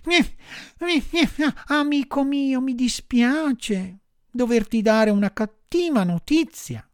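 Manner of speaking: high marmot voice, sighing sympathetically; sighing regret. A narrator's reading. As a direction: break the bad news with high-pitched sighs. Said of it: "gli disse sospirando".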